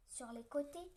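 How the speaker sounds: ordinary delivery, nothing marked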